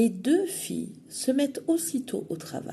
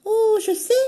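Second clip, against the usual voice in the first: put-on much higher girlish voice; 14 semitones higher, 9 dB louder than usual. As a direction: chirp it back brightly. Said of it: "répond le chaperon rouge".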